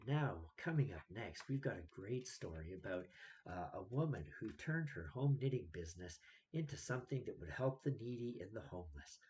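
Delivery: mock the calm NPR anchor, hushed and soothing; soft NPR radio voice. Vlogger mocking radio hosts' fake soft voice. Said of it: "that stupid fake soft voice".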